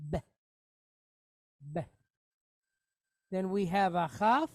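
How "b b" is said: B with a very short e, almost beh; quick vocal shva. Shown as a demonstration. Teacher demonstrates quick B for students. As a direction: clip the vowel after B. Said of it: "a B sound with a quick E".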